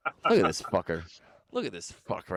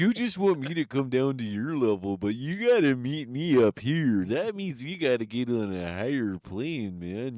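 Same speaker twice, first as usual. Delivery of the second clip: Tommy Chong impression; 3 semitones higher than usual. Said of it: "that's my Tommy Chung impression".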